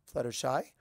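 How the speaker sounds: ordinary delivery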